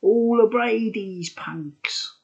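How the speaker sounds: mock the crowd like a punk frontman; quoting the singer. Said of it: "the first thing he said was".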